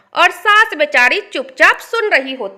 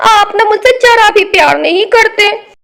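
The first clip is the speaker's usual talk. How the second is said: high, loud tearful whining; wife wails at husband, hammed-up crying; fake crying; 5 semitones higher, 6 dB louder than usual. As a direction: sob and whine to guilt the husband. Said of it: "क्यों रो रही हो?".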